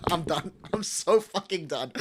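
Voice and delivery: quiet, low words through laughter; helpless laughter. Retold or imitated. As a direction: give up, laughing under your breath. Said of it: "And I just went like".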